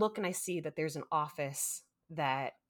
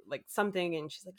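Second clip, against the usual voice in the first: ordinary talk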